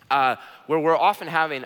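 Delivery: ordinary talk, nothing marked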